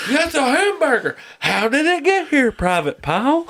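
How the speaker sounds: high-pitched drill sergeant interrogation; Full Metal Jacket sergeant. Acted. Sergeant grilling a private, hammed-up impression. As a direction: do an outraged sergeant, breaking into laughter. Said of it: "fucking Full Metal Jacket".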